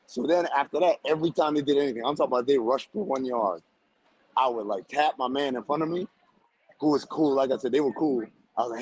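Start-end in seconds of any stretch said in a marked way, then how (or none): none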